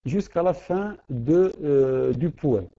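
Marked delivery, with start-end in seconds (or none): none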